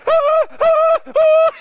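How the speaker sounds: very high, loud yelping whine; dog whining. Retold or imitated. Finn whining with a Chihuahua on his lip, high loud yelps. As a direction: yelp like a desperate dog in pain. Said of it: "He's like".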